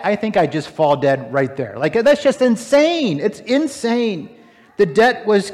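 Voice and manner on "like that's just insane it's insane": ordinary talk, nothing marked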